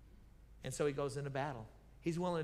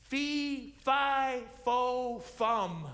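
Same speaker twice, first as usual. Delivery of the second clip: high, loud booming fee fi fo fum; preacher hamming up the giant's Philistine talk, loud and high; 7 semitones higher, 7 dB louder, much slower than usual. Retold or imitated. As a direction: boom it out like a fairy-tale giant. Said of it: "how you speak in Philistine".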